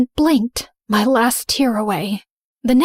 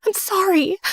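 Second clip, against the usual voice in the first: higher, desperate sorry; pleading apology; 9 semitones higher than usual. Acted. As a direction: beg her forgiveness. Rose begs forgiveness.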